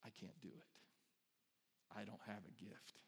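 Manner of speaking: low, hushed self-doubt; defeated excuse. Acted. Believer making excuses about gifts, low and quiet. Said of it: "all the excuses we use to say".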